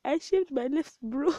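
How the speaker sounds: high, loud, slow words through laughter; confessing the shaved brow through laughter; laughing and crying. Real, not acted. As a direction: force the words out while cracking up. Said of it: "I'm laughing and crying".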